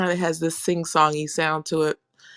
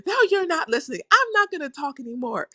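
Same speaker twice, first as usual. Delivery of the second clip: put-on high, fast, indignant old mother; mother complaining; 11 semitones higher, faster than usual. The mother scolding them for not listening, high and indignant. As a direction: play her mom protesting she's ignored.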